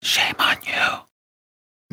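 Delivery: slow breathy whisper. Pretend. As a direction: hush it out slowly, like a secret. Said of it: "What do we say, Jose?".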